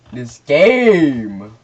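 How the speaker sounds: loud, exasperated cry; angry yell at the game. Real, not acted. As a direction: blow up at the game. Raging at the game.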